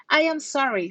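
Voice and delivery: usual speaking voice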